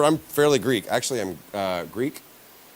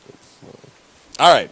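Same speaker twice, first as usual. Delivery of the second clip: ordinary talk, nothing marked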